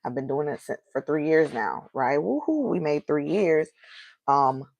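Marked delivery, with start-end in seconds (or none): 2.2-2.7 high-pitched woohoo at ordinary volume; little cheer. Real, not acted. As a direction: give a small, high celebratory whoop. Celebrating three years of podcast.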